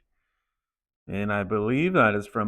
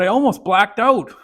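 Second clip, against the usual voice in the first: dramatic recall; 7 semitones higher, 8 dB louder than usual. Retold or imitated. Kid recalling the pain.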